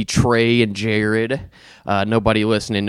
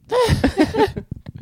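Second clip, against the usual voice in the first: high laugh. Real, not acted. Laughs after singing.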